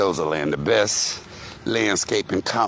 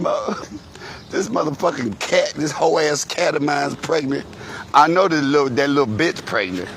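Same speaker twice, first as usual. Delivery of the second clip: mama, upset about cat; 5 semitones higher than usual. Retold or imitated. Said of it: "She said".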